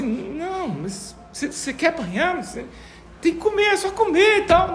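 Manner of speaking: high, pleading mother's voice; pitying mother. Retold or imitated. Preacher voicing the mother coaxing the child, high and pleading. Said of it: "a mãe fica com dó e fala assim".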